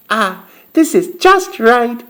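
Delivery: put-on high little-girl voice, pleased; be a little girl finding just what she likes; Goldilocks satisfied. A narrator's reading. Goldilocks tastes Baby Bear's porridge, high and satisfied.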